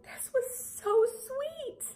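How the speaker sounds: high, slow, crying voice; answering her husband, choked up and crying; teary. Retold or imitated. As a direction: squeak it out through tears. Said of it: "And I'm like".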